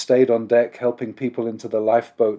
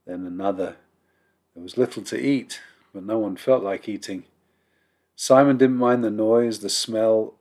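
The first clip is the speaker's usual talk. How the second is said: ordinary delivery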